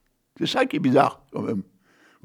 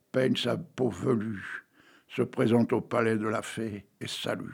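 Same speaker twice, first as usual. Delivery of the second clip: slow, measured verse recital; old poet reciting Hugo; poem reading; 3 dB quieter, slower than usual. Acted. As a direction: read each line like a storyteller. Reads a Victor Hugo poem.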